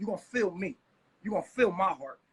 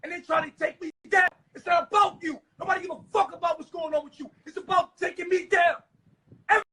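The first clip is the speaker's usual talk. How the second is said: angry shouting; 4 semitones higher, 4 dB louder than usual. Real, not acted. Heated argument with wife.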